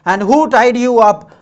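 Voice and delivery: put-on boy asking the freed man; eager question. A narrator's reading. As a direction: push for an answer. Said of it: "asked Arun".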